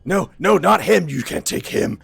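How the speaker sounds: loud, high cry of no that fades to a hiss; ogre's protective shout. Acted. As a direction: shout to protect his friend, then drop away. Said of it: "turns and says".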